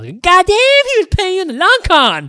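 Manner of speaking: high, loud amazed exclamation; excited outburst. Real, not acted. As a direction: blurt it out in amazed delight. Excited outburst about the con.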